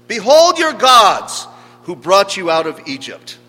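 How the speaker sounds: loud, high-pitched herald's cry; grand proclamation. Acted. Preacher voicing the idol cult's proclamation to the people, loud proclamation. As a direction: proclaim it boldly to a crowd. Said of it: "that was the fundamental proclamation that was offered".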